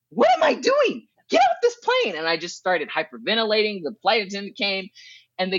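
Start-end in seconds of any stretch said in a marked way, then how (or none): 0.1-2.2 very high retold panic cry; freaking out. Passenger panicking two hours into the flight, freaking out. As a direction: reenact a panic attack, pitch shooting up. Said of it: "I started freaking out".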